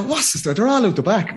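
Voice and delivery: high-pitched, pushy objection; cocky challenge. Retold or imitated. Challenging the woman as if ready to march by her, cocky. Acting out his second go at door.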